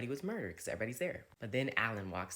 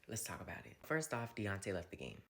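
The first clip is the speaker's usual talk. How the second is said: ordinary delivery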